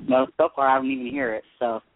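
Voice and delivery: ordinary talk, nothing marked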